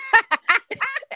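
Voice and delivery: high, loud burst of laughter. Real, not acted. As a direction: crack up mid-story. Laughing while telling story.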